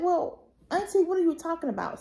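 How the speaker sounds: high-pitched, mildly indignant retort; indignant questioning. Retold or imitated. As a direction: retell a baffled challenge to auntie. Retold confrontation with her aunt.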